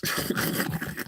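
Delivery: soft, breath-only wheezy laugh; hushed wheeze of laughter. Real, not acted. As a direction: laugh quietly under your breath at your own joke. Laughs after his mock introduction.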